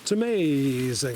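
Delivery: put-on slow, drawn-out Italian-accented amazing. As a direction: say it like an Italian buddy. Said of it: "Italian accent".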